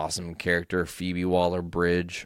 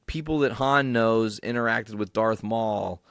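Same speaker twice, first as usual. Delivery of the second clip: usual speaking voice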